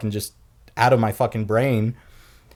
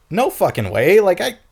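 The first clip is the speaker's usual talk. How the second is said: high, rising, louder disbelief; mock disbelief; 9 semitones higher, 4 dB louder than usual. Friend can't believe he said it, high astonished. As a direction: act floored, climbing higher. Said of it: "And he'd be like".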